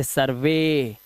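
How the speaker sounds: सर्वे slow, with a long "ay" ending. Shown as a demonstration. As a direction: end सर्वे on a drawn-out "ay", not "ee". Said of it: "ईवाई का उच्चारण ए से करना है".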